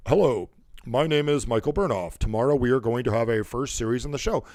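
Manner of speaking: measured, over-formal self-introduction; stiff formal message. Shown as a demonstration. How not to message somebody, stiff and formal. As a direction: recite it like an awkward formal announcement. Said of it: "you don't write".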